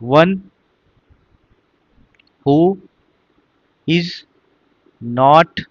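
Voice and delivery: word by word, long pauses between, very slow; slow dictation. Shown as a demonstration. Teacher dictating a definition to students, slow and spaced. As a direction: dictate it so students can write every word. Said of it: "तो इसको लिख लीजिएगा".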